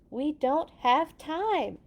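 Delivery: slow, high and loud urging; urgent. Acted. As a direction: press them to hurry. Friend urges hurry.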